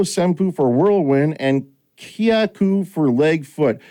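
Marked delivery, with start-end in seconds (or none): none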